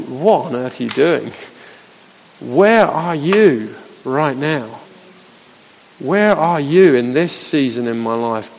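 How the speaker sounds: low, soft, heavy plea to God; hushed, despairing questioning; dark-times anguish. Acted. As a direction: ask slowly, from a dark place. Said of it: "the dark times of our lives".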